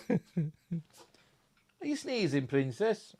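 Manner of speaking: high, soft, drawn-out pet talk; softly fussing over a pet in a high voice; cooing to a pet. Real, not acted. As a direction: coo it slowly to the cat. Cooing to the sneezing cat.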